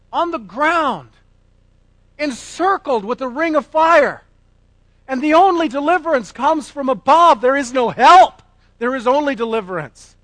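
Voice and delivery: loud, high fiery pulpit preaching; preaching at full force. Acted. Preacher thundering to his congregation about grace, at full force.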